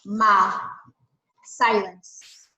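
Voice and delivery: calm, measured picture-book reading with long pauses; story narration. Acted. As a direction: read the story gently to the class, letting the silences sit. Teacher reads picture book aloud.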